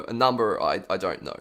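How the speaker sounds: ordinary talk, nothing marked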